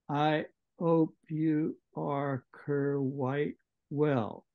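slow, deliberate letter reading; reading a letter aloud. Shown as a demonstration. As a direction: read each phrase out slowly for the class. Teacher reads Pip's letter aloud.

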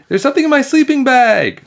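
high, loud squealing alarm; panicked scream. A narrator's reading. Emily in her sleeping bag, panicked scream. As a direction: scream it in a girlish panic pitch. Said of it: "screamed Emily".

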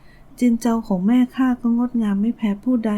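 usual speaking voice

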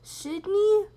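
loud, high rising call; questioning call. Pretend. Player trying both names on the character, loud questioning call. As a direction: call each name out as a question.

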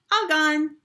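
put-on high, loud toddler voice; little boy. A narrator's reading. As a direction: show off like a proud toddler. Toddler Fudge showing his teeth.

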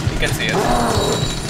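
scared. Real, not acted. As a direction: let the fear slow each word down. Spotted by monster in game.

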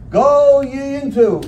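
"go ye into" drawn out very slowly, each word weighted; slow King James emphasis. Shown as a demonstration. Preacher spelling out King James words, slow weighty emphasis. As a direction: lean on "go" in "go ye into" and stretch every word. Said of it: "literally means".

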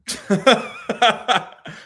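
high laugh; laughing out loud at the joke; burst of laughter. Real, not acted. Reacts to pastor voice.

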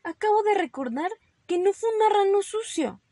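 high, loud protest from a little rabbit; pleading excuse. Acted. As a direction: blurt out a new excuse. Fibbing to escape the bath.

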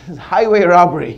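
ordinary delivery

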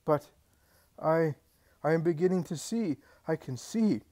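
the blind man suddenly seeing, crying out; joyous amazement breaking into a loud oh; joyful cry. Acted. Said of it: "He staggers in his joy".

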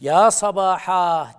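drawn-out, loud warning shout; alarm cry. Retold or imitated. Teacher repeats the alarm call on Safa, loud and drawn out. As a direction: sound the alarm slowly. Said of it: "diyorsa birisi".

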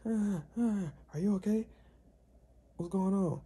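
high, worried mother calling out; concerned mom voice. Retold or imitated. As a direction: play the startled mom checking in. Girl's mom voiced, woken by noise.

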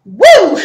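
loud, high whoop of relief. Real, not acted. Relief after challenge.

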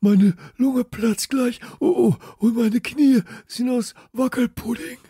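high, loud wheezing, out of breath; gasping hard. Acted. Friedhelm wheezes to his friends after running, badly out of breath. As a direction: puff and wheeze between words. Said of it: "Friedhelm keuchte noch schlimmer".

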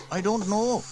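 put-on high boy voice, unsure; young boy James shrugging it off. A narrator's reading. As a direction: voice the young brother. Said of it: "replied James".

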